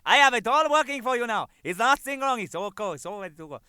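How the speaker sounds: high, loud mock Spanish accent; hammed-up Spanish-accented installer. Installer telling the manager in a mock Spanish accent it works. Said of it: "the guy goes".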